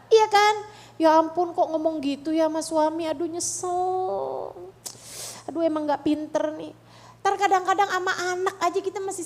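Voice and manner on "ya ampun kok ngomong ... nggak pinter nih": higher-pitched self-reproach, mildly rueful; acted regret. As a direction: imitate someone regretting their own words. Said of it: "kamu kecewa kepada dirimu karena salah ngomong".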